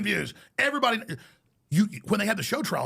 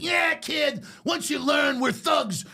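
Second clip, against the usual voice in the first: threatening mobster; 7 semitones higher, slower than usual. Retold or imitated. Mobster threatening the kid, hammed-up shouting thug. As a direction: snarl it like a mafia heavy. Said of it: "he's like".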